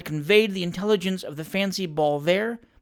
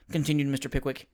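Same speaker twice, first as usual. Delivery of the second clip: ordinary delivery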